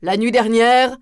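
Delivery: retorting herald. Acted. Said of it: "rétorqua le héraut".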